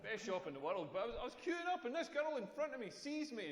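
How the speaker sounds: normal talking voice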